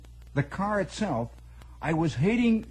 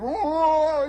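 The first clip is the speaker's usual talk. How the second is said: high yelp; 12 semitones higher, 7 dB louder than usual. Retold or imitated. Voicing the squealing car start.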